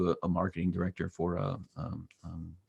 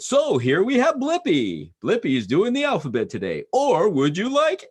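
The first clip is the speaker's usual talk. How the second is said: silly announcer; 10 semitones higher, 6 dB louder than usual. Pretend. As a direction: announce it like a cheesy TV presenter. Dad playing announcer for son.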